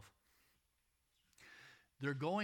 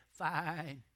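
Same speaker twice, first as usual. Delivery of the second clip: a louder, put-on sheep voice, one word; sheep answering; 6 dB louder than usual. Preacher voicing the sheep replying to the shepherd, mock animal voice. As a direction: answer back as the sheep would. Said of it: "And they'd say".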